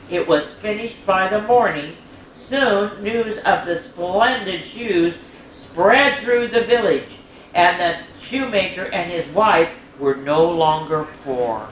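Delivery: bright, lilting storybook narration; reading to children. A narrator's reading. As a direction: read it like a bedtime story for little ones.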